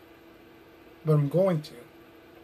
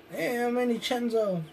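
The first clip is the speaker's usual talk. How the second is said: mock intro; 6 semitones higher, 3 dB quieter than usual. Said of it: "That's what he does".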